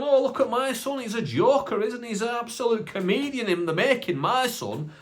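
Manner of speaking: high-pitched imitation of a tickled father; amused dad. Dad taking his kid's answer as a joke, high amused voice. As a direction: act a dad showing off his joker son. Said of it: "And Dad's laughing. He's gone".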